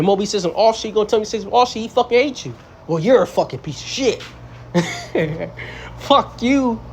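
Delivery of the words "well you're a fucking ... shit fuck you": hammed-up angry fool, high and scornful; mocking a fool. Preacher mimics a rebuked fool cursing him out, mock scorn. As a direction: mimic a rebuked man snapping back.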